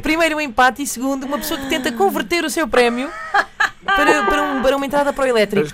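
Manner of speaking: can barely get it out for laughing, speeding up at the end. Real, not acted. Host laughs through the announcement.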